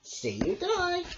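very high grateful squeak; high hedgehog. A narrator's reading. Hedgehog thanks Bramble, high chirp.

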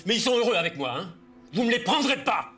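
hostage taker. Acted. Barricaded father refuses to return kids.

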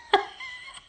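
very high squeal of laughter; squeaky, helpless laugh; real laugh. Real, not acted. Laughs at nonsense phrase.

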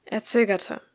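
usual speaking voice

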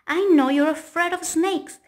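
smug triangle teasing square; gloating. Acted. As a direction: tease him for falling for it. Triangle gloats about trick.

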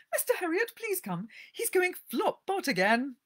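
high, loud, panicky lady's voice; fussy owner begging the vet to come; agitated lady. Acted. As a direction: plead down the phone in a flap. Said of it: "rush to the phone in great agitation".

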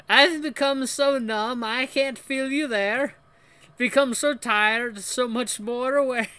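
high, slow, loud mock French. [name] quoting Linkin Park to students, slow fake French accent. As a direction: declaim lyrics in a bad French accent. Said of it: "I'm gonna keep doing it".

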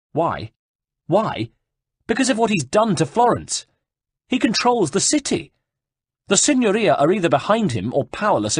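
exiled duke ranting to the herald, high and outraged; ranting exile. Acted. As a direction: pour out his outrage at the monk. Piero's rant against Savonarola.

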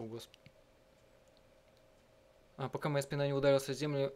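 ordinary talk, nothing marked

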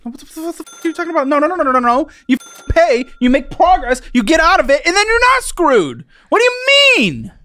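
high, heated disbelief building to a shout; exasperated tirade, climbing; incredulous outrage. Real, not acted. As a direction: lecture them at the top of your pitch. Host quarrels with guest.

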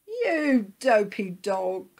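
slow, high, loud mock; singsong bird jeer; giggly teasing. A narrator's reading. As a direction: tease with drawn-out glee. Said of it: "she giggled".

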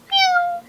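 high meow; high-pitched meow; cat meow. Acted. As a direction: mew like a lazy cat. Cat voiced in story.

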